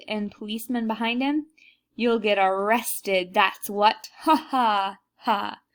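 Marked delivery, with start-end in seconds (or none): 1.9-5.6 gloating laugh. Acted. Guard gloats after his joke.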